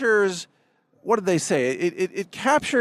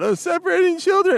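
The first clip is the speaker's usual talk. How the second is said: much higher mock cry; mocking whine; 11 semitones higher than usual. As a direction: mimic fake outrage. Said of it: "they scream and cry about".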